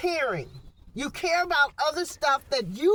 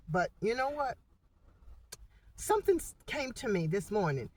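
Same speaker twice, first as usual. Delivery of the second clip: usual speaking voice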